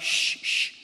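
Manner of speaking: shushing. Retold or imitated. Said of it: "They're like".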